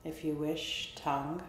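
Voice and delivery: soft, unhurried meditation voice with long pauses; guided body scan. Real, not acted. Therapist guiding listeners through a body scan, soft meditation voice. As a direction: softly call out each body part, leaving space after it.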